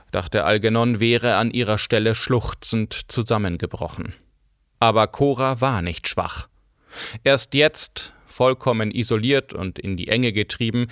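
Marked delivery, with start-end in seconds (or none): none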